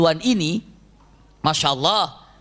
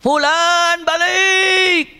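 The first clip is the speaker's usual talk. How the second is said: high, long drawn-out hilltop shout; 10 semitones higher, 4 dB louder, much slower than usual. Retold or imitated. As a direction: bellow the name from a hilltop, stretched slow. (teriakan panjang dan tinggi dari atas bukit)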